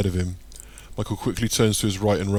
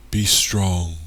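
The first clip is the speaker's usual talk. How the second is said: echoing voice; 4 dB louder than usual. A narrator's reading. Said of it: "echoes Hoto's voice".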